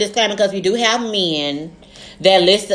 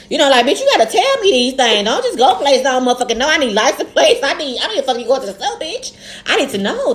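worked-up scolding; 7 semitones higher than usual. Retold or imitated. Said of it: "scolding friend, raised voice".

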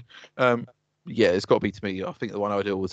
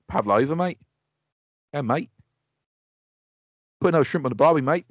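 rough put-on Australian; hammed-up Australian; Australian accent; 3 semitones higher than usual. Shown as a demonstration. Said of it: "Can you do Australian?".

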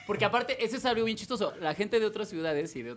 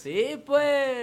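higher, louder copy of Sonoran drawl; imitated Sonoran accent; 7 semitones higher, 5 dB louder than usual. Pupil repeating the lesson, drawn-out Sonoran accent. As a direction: stretch it out like a Sonoran. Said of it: "tienes que alargar todas las palabras".

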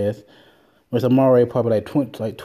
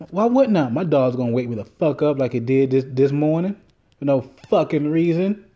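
normal talking voice

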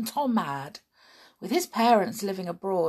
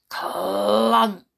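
loud clunk; 2 semitones higher, 9 dB louder than usual. Acted. Sound effect of door.

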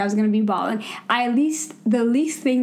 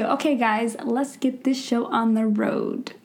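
usual speaking voice

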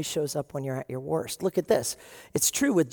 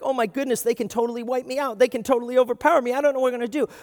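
panicked worrier; 5 semitones higher, 4 dB louder, faster than usual. Retold or imitated. Panicked believer.

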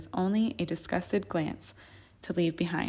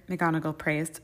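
plain reading voice

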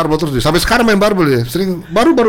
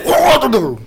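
teriakan ospek tiruan; 6 semitones higher, much slower than usual. Retold or imitated. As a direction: imitate ordering freshmen to bow. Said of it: "Wah, kita kalau teriak". (meniru teriakan senior ospek)

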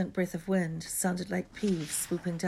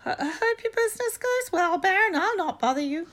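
very high, loud, fawning poltergeist; greasy; 14 semitones higher, 6 dB louder than usual. A narrator's reading. Peeves apologising to the supposed Baron, greasy fawning. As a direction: grovel in a squeaky, oily voice.

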